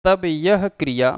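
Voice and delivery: slow, spaced dictation with long pauses; teacher dictating notes, one phrase at a time; dictation pace. Shown as a demonstration. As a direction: read it out phrase by phrase for students to write down. Dictating the definition.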